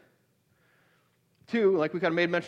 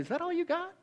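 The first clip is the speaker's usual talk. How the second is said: high-pitched jeering hyena voice; mock the little cub through high laughter; laughing taunt; 13 semitones higher, 5 dB quieter than usual. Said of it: "the hyenas are laughing and saying".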